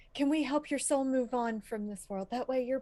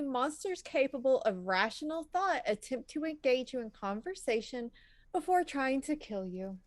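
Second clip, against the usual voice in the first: ordinary delivery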